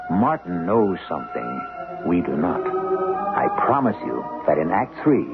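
ordinary talk

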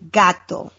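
gato with a crisp Spanish t and open a; Spanish pronunciation. Shown as a demonstration. Teaching a Spanish lesson to co-hosts, careful model pronunciation. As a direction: say gato the Spanish way, short and clean. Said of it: "we're gonna do a lesson, a Spanish lesson".

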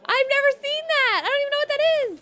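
high, loud exasperated outburst; shrieky protest of total ignorance. Real, not acted. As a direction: insist loudly, pitch way up. Protesting she cannot do impressions.

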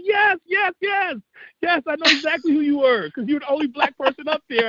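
high, eager re-enactment; retold excited recognition. Sound man recognizing the young extra from the movie, excited. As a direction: act out someone suddenly remembering, pitched well up.

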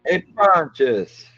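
very high, slow and loud call; hammed-up call across the room; mock call to Pilate. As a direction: stretch it out like hailing someone.

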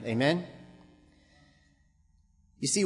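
ordinary talk, nothing marked

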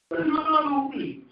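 high, hammed-up whimpering plea; preacher mocking a whiny believer's plea; mock crybaby pleading. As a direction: beg like a sulking child.

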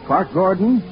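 usual speaking voice

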